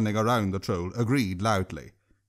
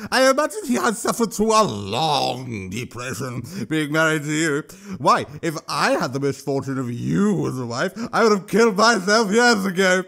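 troll voice =36; 3 semitones higher than usual. A narrator's reading. Troll voice continues.

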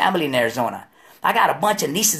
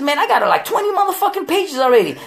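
exasperated complaint; 11 semitones higher than usual. Retold or imitated. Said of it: "I said".

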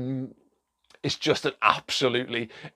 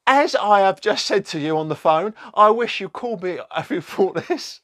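reading her reply in a higher, prim put-on voice; lightly voiced indignant footballer's wife, pitched up; mock Rebecca Vardy voice; 8 semitones higher, 5 dB louder than usual. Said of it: "I don't know if that's a voice".

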